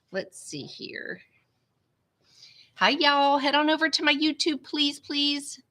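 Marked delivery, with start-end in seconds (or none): none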